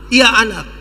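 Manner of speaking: lightly voiced father answering his child; father's responsive reply; welcoming father. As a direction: answer the cry readily, with some force. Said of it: "Dia pun bapak sambut".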